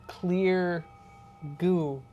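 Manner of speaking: normal talking voice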